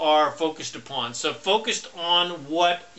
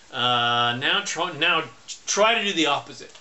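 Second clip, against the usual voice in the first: usual speaking voice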